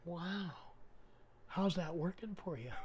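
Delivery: softly retold, understated wow and question; unintended smart-ass. Plumber answering a Satan worshiper, softly unintended smart-ass. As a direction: ask it quietly, without meaning any offence. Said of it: "didn't mean to be a smart ass".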